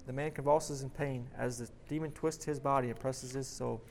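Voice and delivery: ordinary delivery, nothing marked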